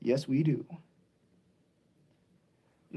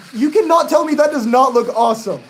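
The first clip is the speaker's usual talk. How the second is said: loud, high gushing; emphatic, raised-voice fan excitement; excited raving; 7 semitones higher, 8 dB louder than usual. Real, not acted. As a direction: rave about it at full enthusiasm. Raving about the trains.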